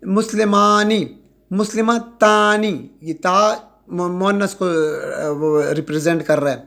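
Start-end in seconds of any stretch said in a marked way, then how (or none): 0.0-2.9 مسلمانی and مسلمتانی said slowly to show آنی; slow demo. Shown as a demonstration. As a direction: stretch each ending for students. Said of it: "آنی کی آواز نکل رہی ہے". (آہستہ اور صاف ادائیگی)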